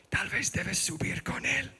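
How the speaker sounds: mother murmuring to the father in the front row, hushed; hushed aside. Retold or imitated. (murmullo de madre en voz baja)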